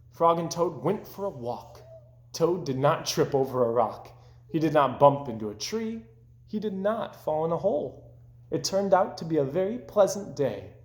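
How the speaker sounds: plain reading voice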